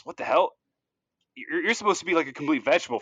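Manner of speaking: jolted out of his wits; startled guard. Acted.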